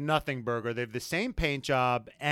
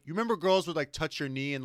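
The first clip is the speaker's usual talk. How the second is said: normal talking voice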